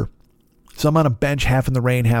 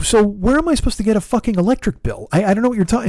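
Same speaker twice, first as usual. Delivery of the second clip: exasperated retort; 8 semitones higher, faster than usual. Retold or imitated. Exasperated at rental counter.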